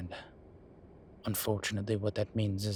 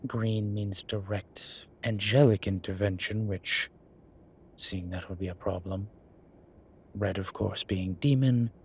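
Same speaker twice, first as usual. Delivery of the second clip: usual speaking voice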